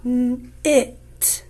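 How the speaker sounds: n held apart from "it", with a break before the vowel; "N-, it" split to isolate the vowel; sounded out. Shown as a demonstration. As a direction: sound out "N-, it" in separate parts. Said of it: "what sound is it making".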